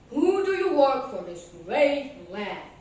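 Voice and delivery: a hint of a grand king asking. King asking land workers who they serve. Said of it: "asked the king".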